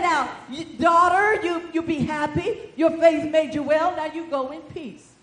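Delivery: loud, high proclaiming blessing; Jesus blessing loudly. Retold or imitated. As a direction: declare the blessing over her. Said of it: "So he said".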